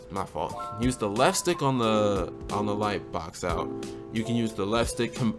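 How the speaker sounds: ordinary talk, nothing marked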